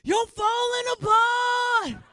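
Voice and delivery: high, drawn-out shout; mock alarmed shout. Parent scaring a kid about falling teeth, mock alarmed shout. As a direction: yell it loud and stretched out.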